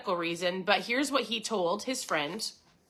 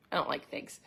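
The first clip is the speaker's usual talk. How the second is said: whispered aside; 8 semitones lower, 8 dB quieter than usual. Real, not acted. Personal aside after quoting diet.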